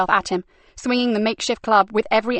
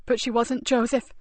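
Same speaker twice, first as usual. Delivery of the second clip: gentle reassuring; 3 semitones higher than usual. A narrator's reading.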